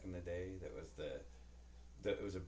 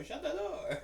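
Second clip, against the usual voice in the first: mock husband; 11 semitones higher, 6 dB louder, much slower than usual. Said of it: "He's all".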